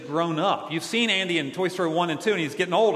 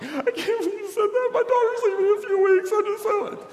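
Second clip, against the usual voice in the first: upset mom. Retold or imitated. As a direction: squeal it like a flustered mother. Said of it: "had a mom who came up to me".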